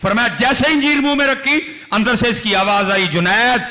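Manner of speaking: loud, high, impassioned sermon storytelling; preaching at full force. Acted. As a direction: preach it out to the crowd with fire. Tells Junaid story at full force.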